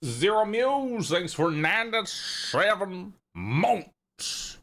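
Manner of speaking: high drawn-out thanks. Acted.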